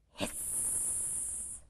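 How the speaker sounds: breathless hissed word; vampire kids hissing. Acted. Six little vampires hiss back at papa, breathy. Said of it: "went the six".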